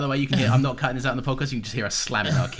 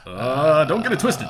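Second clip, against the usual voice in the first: higher, loud mock-menacing line; tough-guy threat; 4 semitones higher, 8 dB louder, slower than usual. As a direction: play a hammed-up movie tough guy. Tough-guy quote banter.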